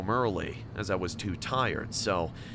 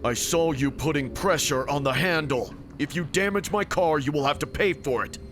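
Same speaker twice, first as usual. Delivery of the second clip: angry; 7 semitones higher, 5 dB louder than usual. A narrator's reading. Driver scolds passenger.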